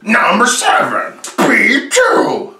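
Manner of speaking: slow, loud dramatic title call; countdown announcer. Acted. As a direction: announce the entry like a game show host.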